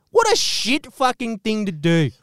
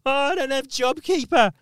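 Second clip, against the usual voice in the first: higher, whiny mock complaint; mock whining; 5 semitones higher than usual. Mocking workers who cried during covid, in a higher whiny voice. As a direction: whine like a sorry-for-yourself worker. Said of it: "fucking crying".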